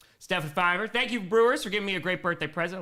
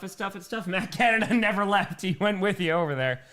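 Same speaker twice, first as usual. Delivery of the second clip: usual speaking voice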